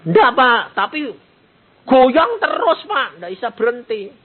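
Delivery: high, slow pleading voice with a laugh; voicing the doctor's daughter begging for prayer. Daughter begs the pastor to pray for her shaking mother, high and pleading.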